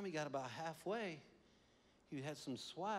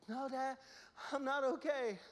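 put-on high, rushed child voice; a frightened kid spilling worries; panicky little boy; 10 semitones higher, 4 dB louder than usual. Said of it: "exactly how he said it".

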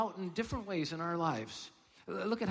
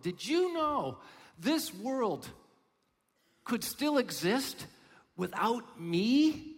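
mock incredulous; 6 semitones higher, 5 dB louder, much slower than usual. Mocking self-centered listener.

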